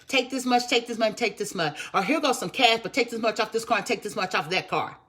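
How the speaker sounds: high, loud mock plea at the checkout; hammed-up shopper splitting a bill; pleading customer. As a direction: beg the cashier to split the payment. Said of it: "you had to do it in a split three payment".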